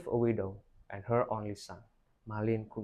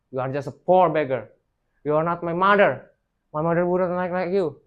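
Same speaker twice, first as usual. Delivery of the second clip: angry; 6 semitones higher, 9 dB louder than usual. A narrator's reading. Son rejecting mother angrily.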